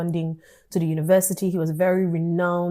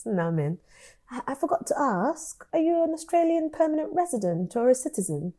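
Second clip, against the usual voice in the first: imitated British accent, higher and soft; 6 semitones higher, 6 dB quieter than usual. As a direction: do a gently hesitant British supervisor. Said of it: "so he gave me British accent".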